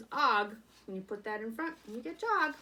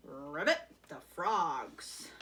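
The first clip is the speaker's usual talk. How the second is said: 1 frog croak; 5 semitones lower, much slower than usual. Acted.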